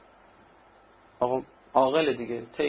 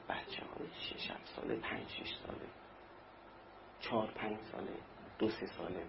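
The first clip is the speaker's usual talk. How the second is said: hushed whisper; 5 semitones lower, 16 dB quieter than usual. Acted. Preacher lowers voice on the children.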